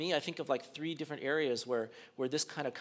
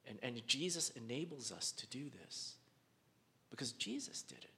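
softer, whispered conviction; 4 semitones lower, 11 dB quieter than usual. Real, not acted. Preacher closes his point to the congregation, whispering. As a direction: let it fall to a whisper.

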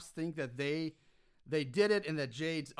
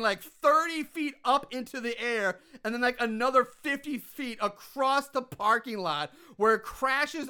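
amazed excitement; 5 semitones higher, 6 dB louder than usual. Real, not acted. Host retells the car blowing up to a co-host, thrilled. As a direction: hype every huge detail of the spectacle.

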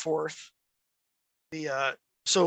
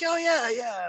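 higher, quoted French colonel; 7 semitones higher than usual. Retold or imitated. French colonel agreeing about Bugs Bunny cartoons, lifted imitating voice. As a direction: give the colonel's eager agreement. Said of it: "a French Special Forces colonel".

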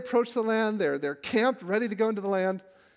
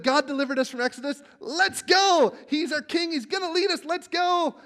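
eager Israelites; 10 semitones higher, 5 dB louder than usual. Retold or imitated. Israelites eager to enter land.

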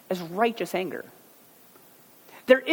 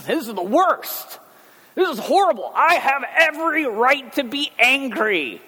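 mock outraged Jonah; 9 semitones higher, 5 dB louder than usual. Preacher voicing Jonah insisting to God he has every right, loud mock outrage. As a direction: rant at God like an indignant prophet.

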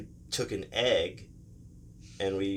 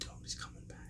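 hushed acted warning; wary mock whisper; 20 dB quieter, much faster than usual. Kids hearing the car return, imitated whispered alarm.

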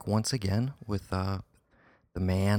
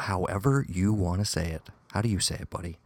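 ordinary talk

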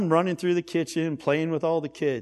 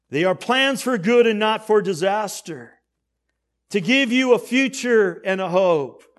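raised, driving pulpit delivery; impassioned preaching; 3 semitones higher, 5 dB louder than usual. Real, not acted. Preacher tells how Jeremiah prophesied to Israel, impassioned preaching. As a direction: preach it out with building force.

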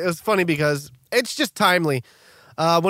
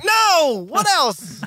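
high, loud mock protest; mock exasperation; 12 semitones higher, 4 dB louder, much slower than usual. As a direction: retell it as an exasperated cry. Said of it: "'cause he knows we're like".

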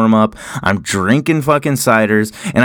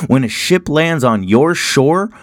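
usual speaking voice

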